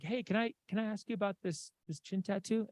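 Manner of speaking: tentative approach. Retold or imitated. Retelling own tentative approach to stranger.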